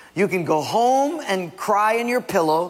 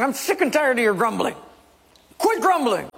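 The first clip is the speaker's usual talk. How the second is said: raised, higher-pitched exasperation; fed-up Moses; 8 semitones higher than usual. Acted. Moses to the grumbling people, exasperated. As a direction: scold a grumbling crowd, pitch climbing.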